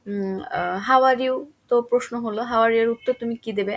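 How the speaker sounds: normal talking voice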